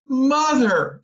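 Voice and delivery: a high, loud horrified cry; girl cries out to mother, horrified. A narrator's reading. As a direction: cry out at the sight in the oven. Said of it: "she cried, horrified".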